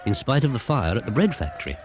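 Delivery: ordinary delivery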